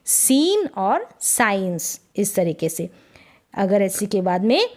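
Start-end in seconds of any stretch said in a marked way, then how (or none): 0.0-2.0 सीन और साइंस drawn out with a clean s; slow clear s. Shown as a demonstration. Teacher to class, slow s model. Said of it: "स ही निकलेगा".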